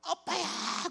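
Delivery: high, pleading call to his daughter; father pleading at his daughter's bedside; desperate plea. Retold or imitated. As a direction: beg her to wake, voice straining high. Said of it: "그랬어요, 제가요".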